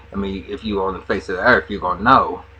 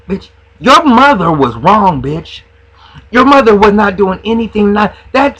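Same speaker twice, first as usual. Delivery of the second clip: scolding shout; 10 semitones higher, 12 dB louder than usual. Real, not acted. Scolding Lyrica's mother directly.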